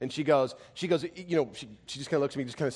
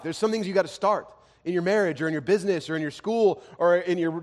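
raised, louder preaching voice that presses each question; preaching at full force; 8 semitones higher, 9 dB louder than usual. Acted. Pastor urges the listeners with fervent, raised preaching.

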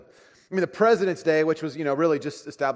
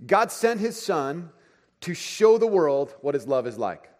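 slow reading; slower than usual. Shown as a demonstration. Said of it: "I got this from a book".

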